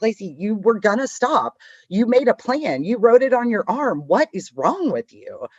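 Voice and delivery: mock-stern talking-to; self-scolding. Scolding herself after failing to stop.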